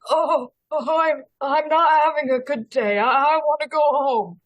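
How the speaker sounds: a muddled old man near tears, only lightly voiced; confused old man. Player as old man, lost and wanting home, lightly voiced. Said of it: "confused old man".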